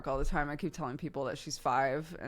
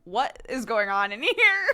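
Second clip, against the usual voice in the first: mock bewildered cry; 5 semitones higher, 9 dB louder than usual. Friends' confused messages, retold.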